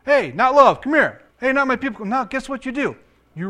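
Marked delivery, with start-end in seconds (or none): 0.1-2.1 high, loud call across the yard; summoning shout; calling the kids. Acted. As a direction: holler for a child by name, raised pitch. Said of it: "Remember the names of the kids, how bad they were?".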